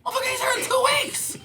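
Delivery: high, fast, shouted mock rant with a laugh; incredulous outburst. Comic yells at fans choosing Kevin's show, mock outrage. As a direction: blow up in disbelief, half laughing. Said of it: "I go".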